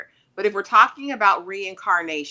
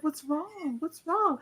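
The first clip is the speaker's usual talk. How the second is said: higher, softer anxious questioning; worried Victor; 9 semitones higher, 8 dB quieter, slower than usual. Retold or imitated. Victor asking Tabitha why she backs away, anxious higher voice. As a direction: ask it like a scared boy.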